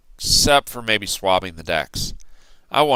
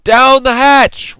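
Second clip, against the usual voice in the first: much higher, loud slow chorus; crew shout; 11 dB louder, slower than usual. A narrator's reading. Pirate crew answering the toast, loud shout. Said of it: "we all shouted".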